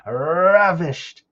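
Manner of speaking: big, drawn-out, forceful "Ravished"; emphasis on Ravished. Shown as a demonstration. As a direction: roll and stretch the start of "Ravished". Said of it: "I put some emphasis on that".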